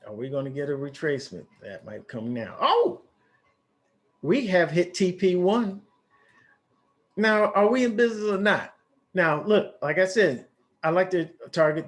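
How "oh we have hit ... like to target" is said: a little excited. Real, not acted. Trader announcing target one is hit, excited. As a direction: voice jumps up high with real excitement. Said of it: "my voice got, uh, a little higher 'cause I'm a little excited".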